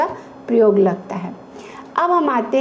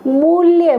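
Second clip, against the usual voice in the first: मूल्य said higher and louder, with a long ऊ and rounded lips; long vowel demo; 5 semitones higher, 5 dB louder, slower than usual. Shown as a demonstration. Teacher to children, showing the long ऊ in मूल्य, loud and drawn out. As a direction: stretch the ऊ in मूल्य and push the lips out. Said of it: "lips में changes आते हैं".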